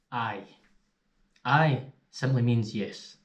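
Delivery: usual speaking voice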